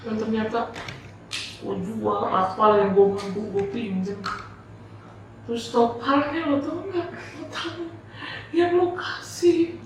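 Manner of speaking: high, strained imitation of crying; acted weepy plea, pitched far up; tearful retelling. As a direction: voice someone pouring out bad news through tears. Retells tearful confession of illness.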